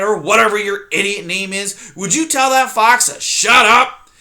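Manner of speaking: put-on loud, cranky old porcupine; loud scornful old porcupine; cranky porcupine cry. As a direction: call out the wrong names with scorn. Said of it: "Erith cried".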